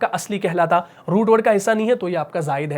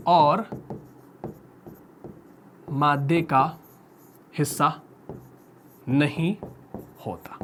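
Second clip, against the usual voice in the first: slow dictation; 4 semitones lower, 5 dB quieter, slower than usual. Shown as a demonstration. Teacher dictating definition slowly.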